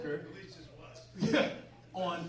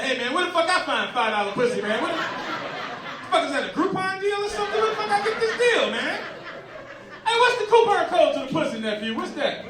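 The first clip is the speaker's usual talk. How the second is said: loud uncle; 11 semitones higher, 13 dB louder than usual. Retold or imitated. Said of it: "He said".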